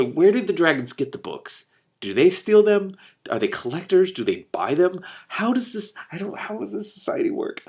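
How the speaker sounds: bursting with curiosity; excited questions. Real, not acted. Reader puzzling over dragon society, excited.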